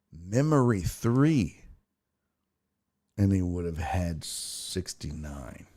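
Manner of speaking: slow and deliberate, soft at the end; drawn-out reading of a baffling message; slow puzzled reading. Real, not acted. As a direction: spell it out word by word, baffled. Reads garbled message again, slowly.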